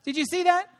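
usual speaking voice

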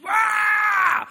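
put-on sudden high shrieked "Rah!" like a furious toddler; imitated toddler scream. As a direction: mimic a child's tantrum scream. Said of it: "she'd start banging on that door".

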